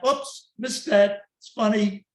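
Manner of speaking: breezy "oops" to the audience; laughing off a mistake. Acted. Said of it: "I laugh".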